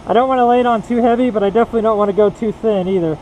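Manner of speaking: muffled behind a full face mask; talking through a mask. Real, not acted. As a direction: voice dulled by a painting mask. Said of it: "I'm talking through a mask".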